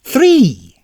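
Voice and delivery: impatient repeat. Acted. Repeating menu choice, impatient.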